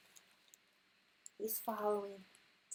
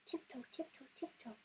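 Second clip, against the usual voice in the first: softer, rushed tiptoe; quick hush; 2 semitones higher, 10 dB quieter than usual. Acted. Mother reading the escape, suddenly hushed. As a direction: drop to a hurried hush. Sneaking out of the cave.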